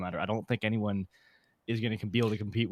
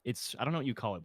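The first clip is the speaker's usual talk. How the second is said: ordinary talk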